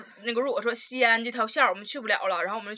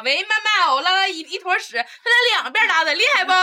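high, loud, boastful report to mum; mock child phoning mum; 7 semitones higher, 6 dB louder than usual. Friend mocking pal's proud phone call to mum, high boastful voice. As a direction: show off proudly in a raised voice. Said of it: "他就给他妈打电话".

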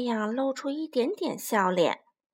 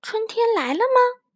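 high, curious little voice, louder; eager child voice; 10 semitones higher, 5 dB louder than usual. Acted. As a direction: wonder aloud hopefully. Kids searching for spring.

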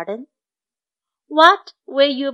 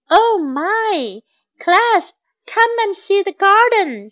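higher, lightly excited call to a class; 7 semitones higher than usual. A narrator's reading. Teacher calls her class to see the garden, excited calling. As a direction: call the children over, pitched up.

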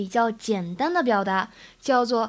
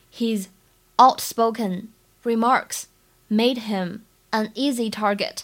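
slow, phrase by phrase with pauses; unhurried dictation with gaps between phrases; slow model reading; slower than usual. Shown as a demonstration. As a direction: read it out in careful chunks for learners. Teacher repeats it slowly in chunks.

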